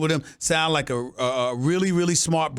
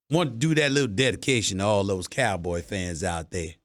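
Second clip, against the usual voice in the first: ordinary talk, nothing marked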